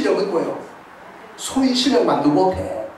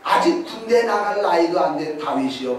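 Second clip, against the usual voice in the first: ordinary talk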